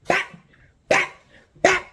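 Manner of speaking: sharp spaced "bap" impacts; imitated blows. Acting out hitting him with the buckle, sharp blows. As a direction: punch out each hit with a pause between.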